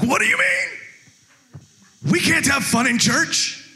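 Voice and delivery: high, drawn-out indignant squawk; mock outrage. Pastor mimicking churchgoers up in arms about fun, mock outraged. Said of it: "get up in arms like".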